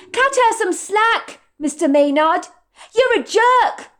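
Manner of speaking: high, loud, slow outburst at the boss; a young waitress yelling at her boss; young waitress shouting. Acted. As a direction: let it fly, high and indignant. Madison voiced shouting at manager.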